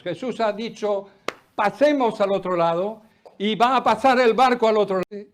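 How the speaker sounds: loud, high, full-force praise; fiery preaching. Acted. Preacher gives thanks for the Bible to the congregation, loud fervent preaching. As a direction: preach it out, lifting the room.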